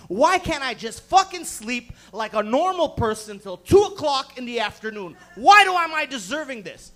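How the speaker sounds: loud, mock-anguished complaint; exasperated rant. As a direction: cry out at the injustice. Exasperated lament in retold chaos.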